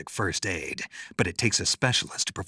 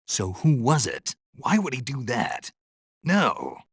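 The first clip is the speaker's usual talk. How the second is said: raised, higher outraged questions; upset and disbelieving, pitch jumping; indignant disbelief; 5 semitones higher than usual. Acted. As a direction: demand to know who did it. Disbelief over who took food.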